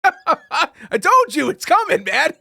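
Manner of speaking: laughing told-you-so, high and slow; giddy I-told-you-so chuckle. Real, not acted. Gloating laugh at the headline.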